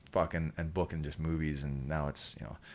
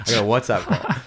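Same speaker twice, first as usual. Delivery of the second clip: higher, much louder mock insistence; loud joking repeat; 5 semitones higher, 11 dB louder than usual. Storyteller teases a yawning listener, loud joking repeat. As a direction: blast it out again, half laughing.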